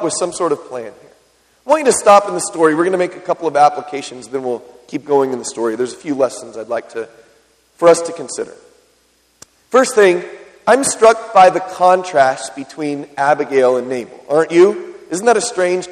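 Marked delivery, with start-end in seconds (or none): none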